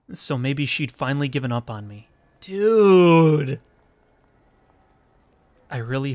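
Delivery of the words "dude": excited shout. Real, not acted. Gamer reacting to the story.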